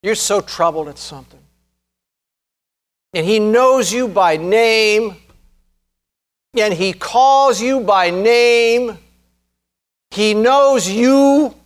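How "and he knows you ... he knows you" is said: drawn-out words, pitched higher; slow, emphatic. Real, not acted. Preacher assures troubled listeners God knows them, slow and emphatic. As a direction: stretch each word out slowly with conviction.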